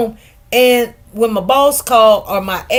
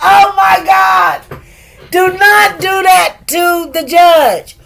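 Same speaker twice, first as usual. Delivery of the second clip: shocked outcry; 5 semitones higher, slower than usual. Real, not acted. Shocked reaction to courtroom attitude.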